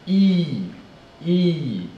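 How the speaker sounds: "E" drawn out high as ee, falling; long e sound. Shown as a demonstration. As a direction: stretch each "E" and let it fall. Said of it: "E sound".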